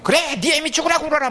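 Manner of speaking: a wounded mother snapping at her crying kid, mocked; mock bitter mother. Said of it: "이상한 소리까지 해요".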